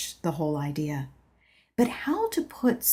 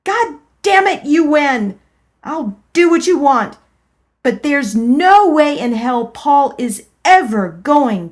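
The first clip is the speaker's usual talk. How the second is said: loud, much higher giving in; furious yell; 7 semitones higher, 10 dB louder than usual. Acted. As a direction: shout in defeat. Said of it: "I yelled".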